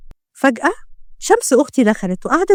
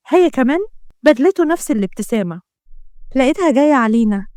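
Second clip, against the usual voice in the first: ordinary delivery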